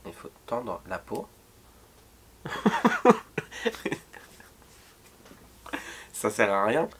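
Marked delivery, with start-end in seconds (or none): 2.4-4.2 breathy high laugh. Real, not acted. Tape on a bald spot fails.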